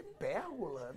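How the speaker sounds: low, soft puzzled echo; baffled husband. Retold or imitated. Husband puzzled by wife's word, low baffled echo. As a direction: repeat it quietly, at a loss.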